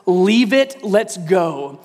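usual speaking voice

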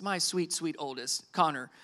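soft, low, fond aside; gentle and affectionate; tender. Real, not acted. Fond aside about sensitive son.

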